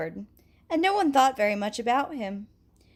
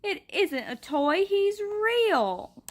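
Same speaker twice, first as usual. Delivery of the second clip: put-on earnest boy insisting; 9 semitones higher, much slower than usual. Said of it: "he said".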